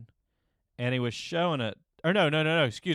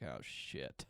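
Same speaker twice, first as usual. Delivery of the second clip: low aside; 13 semitones lower, 16 dB quieter than usual. Retold or imitated. Friend's silent look.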